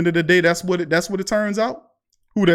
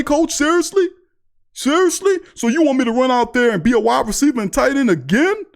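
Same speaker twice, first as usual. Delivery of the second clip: indignant Taysom; 9 semitones higher than usual. Retold or imitated. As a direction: climb into a squeaky disbelieving "seriously?". Taysom confronting coach, acted quarrel.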